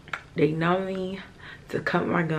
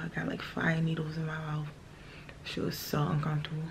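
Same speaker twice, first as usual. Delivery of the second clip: softer hushed whisper of pain; 9 dB quieter than usual. Real, not acted. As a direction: breathe the words out, sore mouth. Numb, swollen, whispering to camera.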